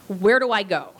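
usual speaking voice

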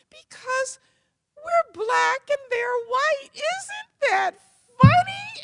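mock the idea with delight; amused mom. Mom mocks racists to child.

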